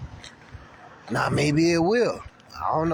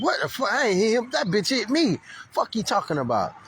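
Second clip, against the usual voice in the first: much higher, incredulous yell; indignant outburst; 7 semitones higher than usual. Retold or imitated. Cyclist to the police who blame him, high indignant yell. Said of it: "I'm like".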